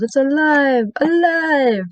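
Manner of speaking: high, drawn-out eureka cry; hammed-up, high-pitched monster-movie yell; mad-scientist yell. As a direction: imitate a mad scientist crying out in triumph. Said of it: "as he yells".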